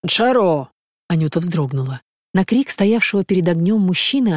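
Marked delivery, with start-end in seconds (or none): 0.0-0.7 drawn-out call to someone off; mildly raised calling voice; loud call. A narrator's reading. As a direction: call out the name, holding the last vowel. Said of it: "сказал он громко".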